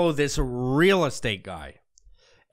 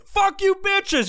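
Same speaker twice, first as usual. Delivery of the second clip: high, loud, slow drawn-out curse; hammed-up road rage yell; mock yell; 5 dB louder, much slower than usual. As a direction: shout it slowly at the other drivers. Rubbernecker cursing at drivers.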